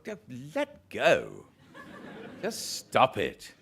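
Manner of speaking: slow, fussy little voice telling himself off; drawn-out inner scolding, laughing; mock Poirot self-scolding. Telling himself to stop fussing.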